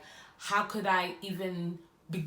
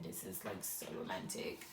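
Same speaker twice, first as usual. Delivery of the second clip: much lower, soft whispered swoon; dreamy whisper; 12 semitones lower, 13 dB quieter than usual. Retold or imitated. Retelling her lovestruck thought, a soft dreamy whisper. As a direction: sigh it out, lovestruck.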